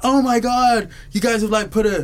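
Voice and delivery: high, excited mock American; loud American-accented exclaiming. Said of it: "that's an American accent".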